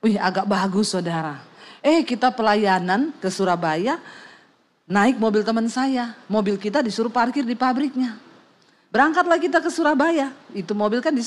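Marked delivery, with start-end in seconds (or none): none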